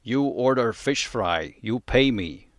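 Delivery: put-on slow, heavy Eastern European accent; imitating an Eastern European vendor demanding payment. Vendor demanding payment for fish fry, in an Eastern European accent. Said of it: "he had an Eastern European accent".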